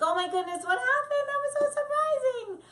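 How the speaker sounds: very high, loud sing-song nanny; Mary Poppins. Shown as a demonstration. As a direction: gush like a cheery nanny. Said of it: "I go very Mary Poppins".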